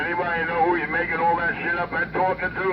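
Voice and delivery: ordinary talk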